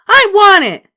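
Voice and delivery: very high, loud tantrum scream; screaming child. A narrator's reading. Little girl screams for the sucker, tantrum. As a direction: wail it in a tantrum.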